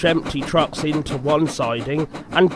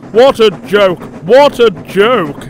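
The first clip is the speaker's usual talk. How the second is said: loud, raised gloating chortle; chortling; 5 semitones higher, 8 dB louder than usual. A narrator's reading. Gordon enjoys Thomas's trouble, loud chortling. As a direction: chortle it heartily, slower the second time. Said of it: "he chortled".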